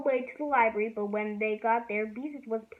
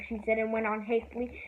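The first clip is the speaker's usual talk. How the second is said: ordinary delivery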